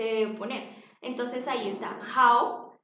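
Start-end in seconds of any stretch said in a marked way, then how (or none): 2.2-2.7 "how" said loud and clearly for learners; teacher's loud demonstration of "how"; pronunciation model. Shown as a demonstration. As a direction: model the word "how" emphatically. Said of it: "la pronunciación es".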